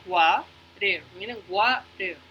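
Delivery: "water" split slowly into two syllables; separado en sílabas. Shown as a demonstration. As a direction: break "water" into wa, ter. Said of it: "las palabras pequeñas". ("water" despacio, sílaba por sílaba)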